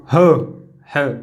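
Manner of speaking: ह said as a short breathy half-sound; visarga sound demo. Shown as a demonstration. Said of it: "इसका उच्चारण". (विसर्ग जैसा आधा ह)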